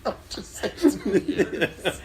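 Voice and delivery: slow words squeezed through laughter; laughing. Real, not acted. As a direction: barely get it out for laughing. Cracking up teasing a friend.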